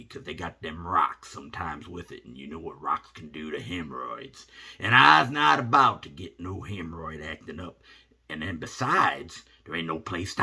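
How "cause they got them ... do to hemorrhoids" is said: ordinary delivery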